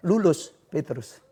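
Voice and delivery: ordinary talk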